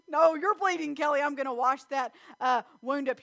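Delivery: firm nurse mom taking charge; insistent mom. Retold or imitated. Mother insisting on washing wound.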